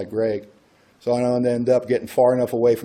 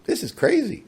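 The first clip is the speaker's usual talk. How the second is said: incredulous; 12 semitones higher than usual. Retold or imitated. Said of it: "I'm just like".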